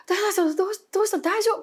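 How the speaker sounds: high, urgent repeated question; frantic asking. Retold or imitated. Door opens on shaken roommate, high alarmed asking. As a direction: press him for an answer, alarmed.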